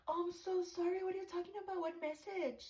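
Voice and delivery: high, sing-song fake innocence; fake innocent. Panini feigning innocence, acted quote.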